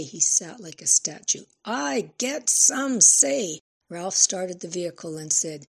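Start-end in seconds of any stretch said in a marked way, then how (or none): 1.6-3.6 high, slow, loud, each word stretched; drawn-out scream. A narrator's reading. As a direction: drag out every word in anger. Repeated scream, slower.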